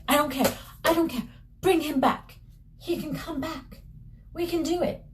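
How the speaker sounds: high, insistent acted mother; desperate pleading. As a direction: plead frantically, pitched well up. Mother pleads for dead son's return.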